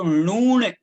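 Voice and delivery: नून with a long, held ऊ; slow, emphatic model of नून; pronunciation correction. Shown as a demonstration. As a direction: stretch the vowel in नून slowly. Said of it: "इसका उच्चारण ठीक होना चाहिए".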